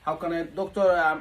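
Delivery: usual speaking voice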